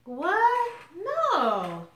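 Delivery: high, loud shocked "What?"; surprised. Real, not acted. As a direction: blurt it out in disbelief. Reacting to a viewer comment.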